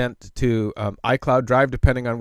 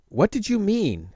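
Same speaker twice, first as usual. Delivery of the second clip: higher, puzzled question; puzzled wife; 8 semitones higher than usual. Retold or imitated. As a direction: ask it like a confused wife. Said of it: "Her reply is".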